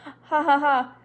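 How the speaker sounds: loud, high "Ha, ha, ha" with a harsh edge; grating laugh. A narrator's reading. Miss Priscilla laughing gratingly at Elizabeth, a harsh fake laugh. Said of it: "Miss Priscilla laughed gratingly".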